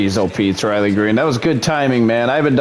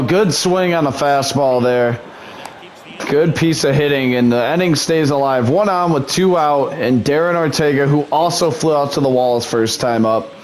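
plain; 3 semitones higher than usual. Acted.

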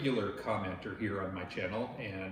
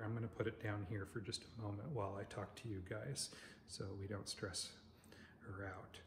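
lowered voice; 9 dB quieter than usual. Real, not acted. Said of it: "I have to not direct my voice towards our friend here".

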